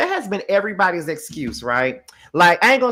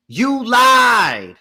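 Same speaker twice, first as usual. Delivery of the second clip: louder, higher flat accusation; accusing; 6 semitones higher, 7 dB louder than usual. Real, not acted. Host accusing Angela of lying, louder. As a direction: call her out flat.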